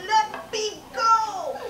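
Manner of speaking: high, drawn-out pinched-nose plea. Acted. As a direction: plead slowly as if your nose is clamped. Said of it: "You're squeezing my nose".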